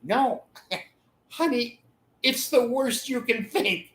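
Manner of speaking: squeaky sheepish confession; sheepish husband. Retold or imitated. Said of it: "And he's gonna say".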